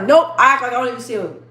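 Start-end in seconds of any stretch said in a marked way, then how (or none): 0.0-0.3 flat refusal. Retold or imitated. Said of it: "I'm like".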